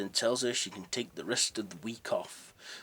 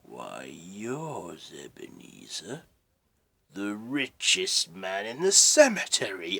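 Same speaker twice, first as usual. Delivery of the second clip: menacing spirit; 7 dB louder, slower than usual. Acted. Ghost reveals skeleton face.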